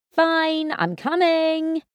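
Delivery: high, slow call over distance; calling back. Acted. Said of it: "I called back to Rory".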